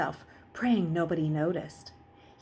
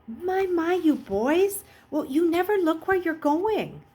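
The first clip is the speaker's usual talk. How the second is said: high, louder fussing grandmother; fussing old woman; 9 semitones higher, 4 dB louder than usual. Acted. Old nurse fussing at the boys, high and clucking. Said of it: "the woman fu-, fussed".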